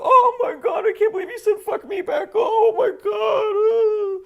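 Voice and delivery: a whiny meltdown squeal with fake sobs; mock hysterical Republican. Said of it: "And then they go".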